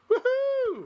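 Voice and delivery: high excited whoop; cheering woohoo. Real, not acted. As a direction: whoop it out in celebration. Cheering a milestone.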